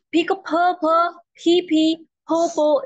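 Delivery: purple with a strong puff of air on each P; aspirated P demo. Shown as a demonstration. Teacher demonstrating aspirated P to the class. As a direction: breathe out hard on every P in purple. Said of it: "इस तरह से पढ़ना है".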